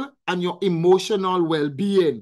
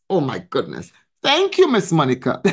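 ordinary delivery

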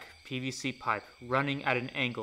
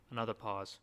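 ordinary delivery, nothing marked

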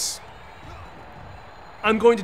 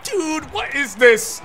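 excited shout; 5 semitones higher, 3 dB louder, slower than usual. Real, not acted. Amazed at game move.

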